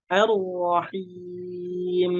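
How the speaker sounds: "Ar-Rahim" chanted very slowly, final m held humming; flowing final mim. Shown as a demonstration. Teacher shows mim not cut off, "Ar-Rahim" with lingering m. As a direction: stretch "Ar-Rahim" and let the m flow out. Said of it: "Seperti itu".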